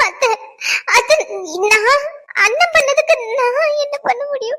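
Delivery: high, slow whining plea; helpless protest. Acted. Accused girl disowning her brother's deed, plaintive. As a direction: plead innocence in a drawn-out high voice.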